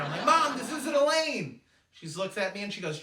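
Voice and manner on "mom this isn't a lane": high, loud, drawn-out protest; exasperated son's retold shout; mock yell. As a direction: yell in alarm at your mom's driving. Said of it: "Try to yell at her".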